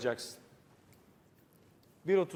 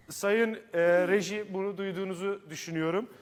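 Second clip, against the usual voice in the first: ordinary talk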